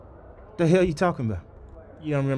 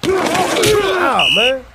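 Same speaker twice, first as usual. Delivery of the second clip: high, loud outcry; loud wail of protest; protesting shout; 13 semitones higher, 5 dB louder than usual. Acted. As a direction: cry out at the foul. Player protests a foul.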